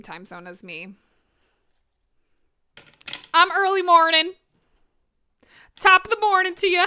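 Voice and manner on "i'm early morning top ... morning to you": bright, loud and higher, bursting with energy; cheery greeting. Real, not acted. Greeting the stream chat at dawn, loud and high. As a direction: beam it out to chat like a sunrise.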